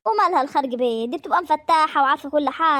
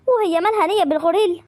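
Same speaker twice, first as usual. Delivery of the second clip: ordinary talk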